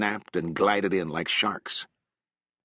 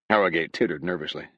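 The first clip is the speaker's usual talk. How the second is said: plain reading voice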